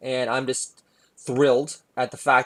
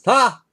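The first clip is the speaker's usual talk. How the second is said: mocking "Ha!"; 10 semitones higher, 8 dB louder than usual. Mocking laugh after a jab.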